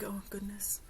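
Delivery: whispered to herself, resigned; barely voiced sigh of dismay; hushed dismay. Camper muttering to herself in her tent, hushed dismay. Acting her weary private reaction.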